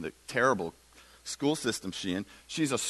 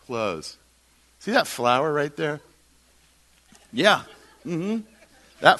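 lively, raised voice pointing at the flower; animated Jesus voice; 5 dB louder than usual. Acted. Preacher voicing Jesus about flowers, animated. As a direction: play Jesus brushing off the question.

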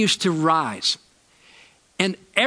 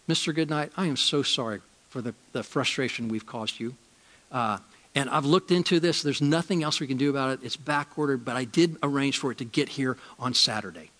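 soft, gentle customer-service voice, much softer; calm, sweet voice; 3 semitones lower, 6 dB quieter than usual. Retold or imitated. Assistant manager calms a frustrated customer, softly and sweetly. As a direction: soothe an angry caller, sweetly. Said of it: "her voice is so nice and so calm and so sweet".

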